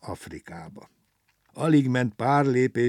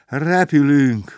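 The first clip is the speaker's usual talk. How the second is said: loud, high, stretched-out cheer; jubilant; 5 semitones higher, 10 dB louder, much slower than usual. A narrator's reading. Passenger feels the plane move, jubilant. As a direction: whoop it out in triumph. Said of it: "újjongott Gombóc Artúr".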